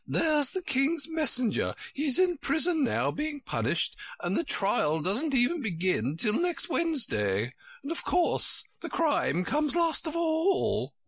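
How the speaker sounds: very high, emphatic storybook queen. A narrator's reading. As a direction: lay out the example in a high queenly voice.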